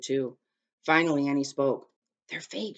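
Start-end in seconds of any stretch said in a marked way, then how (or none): 2.3-2.8 soft, hushed discovery. A narrator's reading. Annie breaks the silence among the soldiers, hushed. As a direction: say it under the breath.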